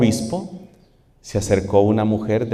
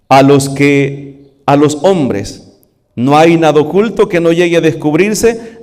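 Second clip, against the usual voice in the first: louder, ringing proclamation; fervent preaching; 5 semitones higher, 4 dB louder than usual. Acted. Preacher booming Jesus's words to the congregation. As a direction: proclaim it from the rooftops.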